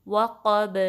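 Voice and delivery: وقب loud, final b bouncing; qalqala. Shown as a demonstration. Teacher demonstrating qalqala, وقب with bouncing b. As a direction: release the b of وقب with a short echo. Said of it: "با ساکن کریں گے تو کلکلا".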